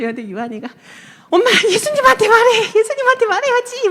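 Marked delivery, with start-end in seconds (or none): 1.3-3.9 put-on high, loud, frantic child's cry; desperate plea. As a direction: beg like a scared little kid. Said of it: "되게 절박하게".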